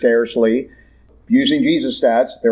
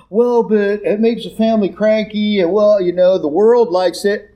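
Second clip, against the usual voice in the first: lame excuse; 5 semitones higher than usual. Acted. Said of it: "get up to the judgment going".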